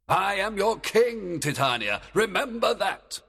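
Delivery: high, loud commanding fairy king; stern king. Acted. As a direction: order her like a stern king. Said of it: "he commanded in a stern voice".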